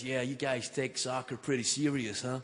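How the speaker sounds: lightly voiced American shop assistant; a touch of quiet American retail worker; shop guy. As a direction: play a soft-spoken, curious store guy. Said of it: "talking to the Abercrombie and Fitch guy".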